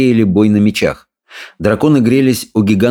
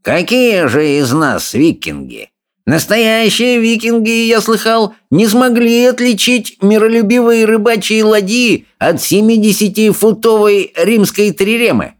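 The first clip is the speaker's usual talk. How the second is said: sneering, sing-song bully, pitched way up; jeering bully; 11 semitones higher than usual. A narrator's reading. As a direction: taunt the others with relish.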